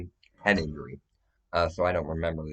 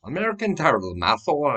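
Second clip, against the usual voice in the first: high, loud mock disbelief; hammed-up shouted protest; acted outraged reaction; 11 semitones higher, 9 dB louder than usual. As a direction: yelp it as a startled, protesting suspect. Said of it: "He's like".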